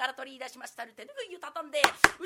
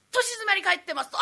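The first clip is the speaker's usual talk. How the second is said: ordinary delivery, nothing marked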